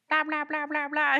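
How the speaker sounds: high, hammed-up blah blah; mock chatter. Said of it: "sitting there".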